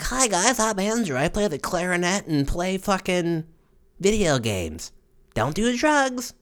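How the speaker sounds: put-on squeaky little-kid voice, way up high; high prepubescent voice. Boy introducing himself to the guys, squeaky high pitch. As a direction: play the nerdy kid before his voice broke. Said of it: "'Cause my voice was high".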